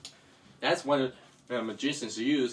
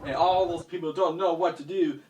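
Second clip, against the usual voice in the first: ordinary delivery, nothing marked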